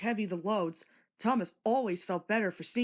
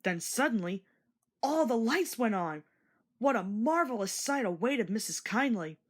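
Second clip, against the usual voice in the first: ordinary delivery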